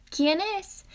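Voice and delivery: high grandmother asking; grandmother's voice. A narrator's reading. Grandmother asking who knocks, high voice. Abuela pregunta en la puerta.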